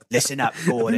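lightly voiced tough British hero; a hint of a gruff British tough guy, for laughs; Jason Statham as Batman. Addressing Gordon as a mock British Batman, lightly voiced. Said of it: "This is Jason Statham as Batman".

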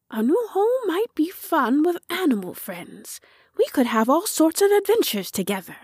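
put-on high, childlike voice gathering speed; small character's eager, high-pitched daydreaming; hopeful little tractor. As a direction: grow keen and quick, pitched high. Tractor warms to a new home.

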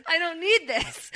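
high, slow, laughing protest; giggly acted outburst; exasperation through laughter. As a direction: try to play fed up while cracking up. [name]'s line, spoken through laughter.

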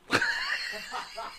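a sudden very high burst of laughter. Real, not acted. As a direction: crack up at your own joke. Laughs after crude joke.

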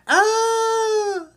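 very high, loud, crying wail; a shrill emotional outburst wail; overwhelmed shriek. Retold or imitated. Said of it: "she's going".

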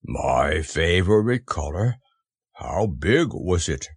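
low, slow donkey voice; heavy, resigned drawl; doleful Eeyore. Acted. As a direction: muse mournfully, dragging the words. Eeyore mourns his balloon.

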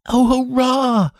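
put-on high, slow, joyful boy's cheer; a boy crying out in delight, drawn out; excited cry. A narrator's reading. Lionel to his hippogriff after trapping the dragon, high excited cheer. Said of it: "he cried".

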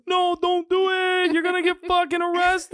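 frantic friends. Retold or imitated. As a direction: squeal it out as a pleading crowd. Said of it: "everybody on my boat was like".

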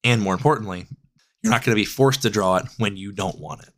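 ordinary talk

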